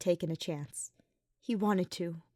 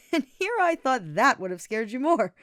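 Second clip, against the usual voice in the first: laughing; 5 semitones higher, 6 dB louder than usual. A narrator's reading. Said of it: "Sylvain laughed".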